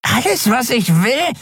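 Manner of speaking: high, creeping dealer voice; oily skeleton voice. Acted. Skeleton dealer creeping closer to Endriel, high. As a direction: whine it like a slimy pest.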